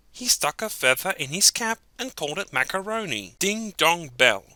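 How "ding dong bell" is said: ordinary delivery, nothing marked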